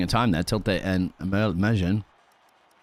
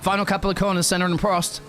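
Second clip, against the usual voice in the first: excited, high race call; live commentary; 12 semitones higher than usual. Real, not acted. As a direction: call the lap as it happens.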